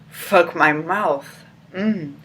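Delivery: ordinary talk, nothing marked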